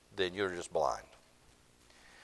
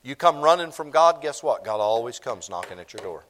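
ordinary delivery

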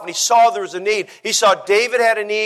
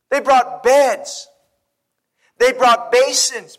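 slow emphatic preaching; 2 semitones higher, much slower than usual. Acted.

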